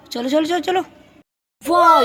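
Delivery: usual speaking voice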